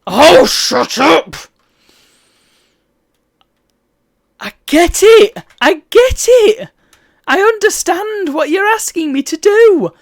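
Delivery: high slow angry yell; frustrated shouting. Real, not acted. Gamer yelling at the game's nagging advisors, slow high shout. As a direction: bellow each word, slowly. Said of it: "I'm sorry if I'm shouting too much".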